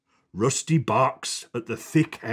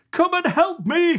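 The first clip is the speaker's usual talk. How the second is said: higher called-out line; calling out. Acted. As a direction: call across the farmyard. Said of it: "calls Ted".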